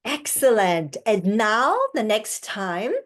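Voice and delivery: usual speaking voice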